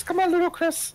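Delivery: high cutesy voice. Pretend. Encouraging teammate playfully.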